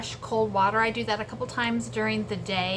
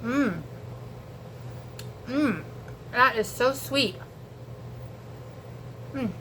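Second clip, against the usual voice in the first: eating while talking; talking through a bite of lettuce; mouth full; 5 dB quieter than usual. Real, not acted. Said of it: "Was that me talking with my mouth full".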